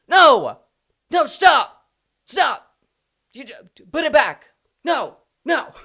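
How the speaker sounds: high, loud pleading shouts; mock horrified crowd. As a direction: act out over-the-top protest, crying out to stop it. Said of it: "everyone's just going".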